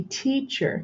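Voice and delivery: "teacher" in two measured syllables, tea-cher; split into syllables. Shown as a demonstration. Teacher recapping the split for the class, "teacher" syllable by syllable. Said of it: "and we split it".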